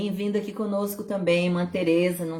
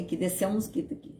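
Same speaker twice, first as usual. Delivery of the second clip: usual speaking voice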